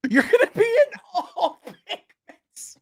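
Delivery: high, slow, through laughter; laughing. Real, not acted. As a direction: say it while cracking up. Laughing at the merch joke.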